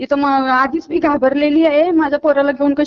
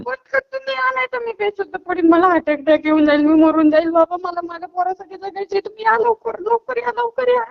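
real crying; 2 semitones higher than usual. Real, not acted. Mother begs caller to come save her child, crying. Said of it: "रडू नका".

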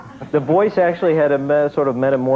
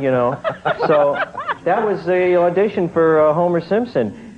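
ordinary delivery

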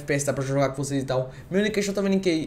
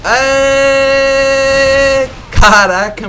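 excited shout; 8 semitones higher, 11 dB louder, much slower than usual. Real, not acted. Celebrating a kill in game.

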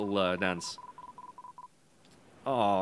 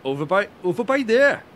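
high, loud go at a Welsh lilt; hammed-up Welsh accent; attempted Welsh accent; 8 semitones higher, 8 dB louder than usual. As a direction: try a Welsh accent, pitched up and punched out. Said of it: "Say, I can't do it".

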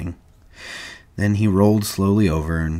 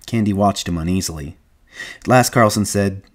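usual speaking voice

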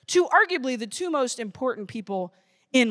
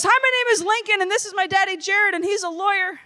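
high-pitched imitation of a schoolboy; little kid voice; 7 semitones higher than usual. Little boy introducing his dad to the class, high imitated kid voice. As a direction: play a small boy proudly announcing his dad. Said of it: "and he goes".

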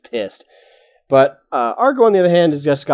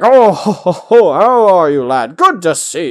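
put-on booming hearty old dwarf laugh and greeting, higher and louder; jolly warm uncle; 6 semitones higher, 8 dB louder than usual. GM greeting the party as a hearty warm uncle. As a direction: greet the lad like a jovial uncle. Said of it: "that warm uncle kinda feel".

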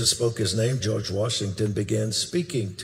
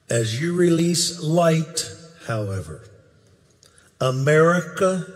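much higher and louder, slowing on key words; prophetic preaching; 8 semitones higher, 8 dB louder than usual. Acted. Preacher voicing Billy Graham's prophecy, at full force. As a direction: thunder it out as prophecy over the people.

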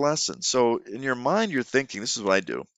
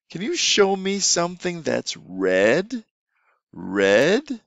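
put-on teacher asking a child to find something red, slow and inviting; slow, raised teacher request; 8 semitones higher, 3 dB louder, much slower than usual. Shown as a demonstration. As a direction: ask it slowly and invitingly. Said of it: "another thing you could throw in".

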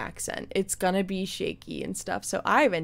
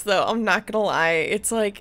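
ordinary talk, nothing marked

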